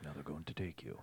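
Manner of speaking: very soft, low warning; hushed warning. Retold or imitated. Friend retells the father's hushed warning. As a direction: breathe the warning. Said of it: "He's like".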